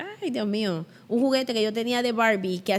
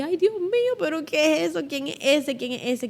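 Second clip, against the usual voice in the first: put-on high, panicked little-girl questions; child frightened in bed at night, high panicked voice; frightened cry; 7 semitones higher than usual. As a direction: gasp it out like a scared child. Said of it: "me tapé y yo dije".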